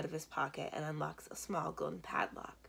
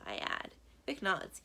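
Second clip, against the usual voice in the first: ordinary delivery, nothing marked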